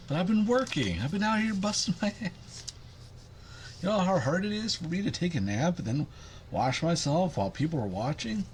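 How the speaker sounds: high put-on cat voice, complaining she has worked hard; cat's voice.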